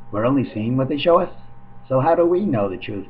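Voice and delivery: ordinary delivery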